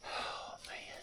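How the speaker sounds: breathless whispered gasp of relief; barely voiced, stunned at the find; awed whisper. Real, not acted. Overwhelmed on finding the bear.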